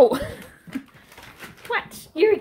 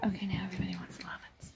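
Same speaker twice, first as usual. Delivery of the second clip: whisper; 10 semitones higher, 7 dB quieter than usual. Real, not acted. Puppies crowding for affection.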